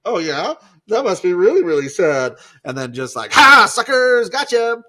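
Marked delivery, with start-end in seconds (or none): 0.1-2.4 loud, high, exaggerated fake concern; mock sympathy. Jesus feigning sympathy, acted. 3.3-4.8 very loud, high gleeful yell; gotcha taunt. Acted. Prank reveal, acted as Jesus.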